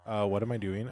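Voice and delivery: ordinary talk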